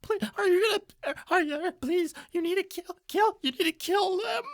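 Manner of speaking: very high, pleading coward voice; whimpering coward. Acted. Cowering man begs the warforged to kill his attacker, high pleading. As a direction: beg in a thin high terrified voice. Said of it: "and he goes".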